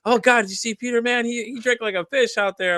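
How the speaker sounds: high, loud mock astonishment; imitated gossip. Friends gossiping about his drinking, mock astonished. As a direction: exclaim it high, like an amazed onlooker.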